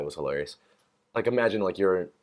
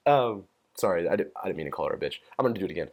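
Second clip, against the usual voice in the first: ordinary delivery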